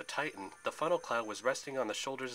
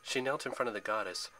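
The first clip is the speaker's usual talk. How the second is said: usual speaking voice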